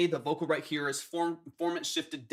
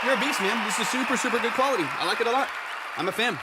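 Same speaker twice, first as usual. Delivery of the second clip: louder and higher, genuinely fired up; hyped praise; 8 semitones higher, 8 dB louder, faster than usual. Real, not acted. Producer cheering the artist's track, excited and louder. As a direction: hype the artist up like you mean it.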